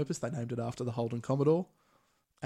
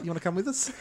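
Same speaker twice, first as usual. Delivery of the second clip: friendly Korg voice; 7 semitones higher, faster than usual. Retold or imitated. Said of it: "Korg being lovely, just like".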